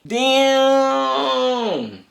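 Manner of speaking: high, loud hyped exclamation; excited shout. Real, not acted. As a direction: yell it out, hyped by the punch. Reacting to the hit.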